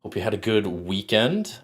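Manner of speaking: ordinary talk, nothing marked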